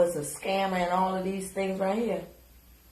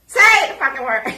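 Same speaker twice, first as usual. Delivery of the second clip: loud, higher-pitched outburst; exasperated demand; 6 semitones higher, 9 dB louder than usual. Real, not acted. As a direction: yell the order, hitting the first word hard. Demanding, repeating after calmer takes.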